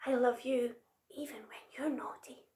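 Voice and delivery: soft, affectionate whisper. A narrator's reading. Little Monkey forgiving Big Monkey, whispering. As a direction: confide it in a whisper. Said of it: "he whispered".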